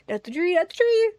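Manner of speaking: beg, alarmed, for the tree; pleading alarm. Real, not acted. Pleading louder at tree strike.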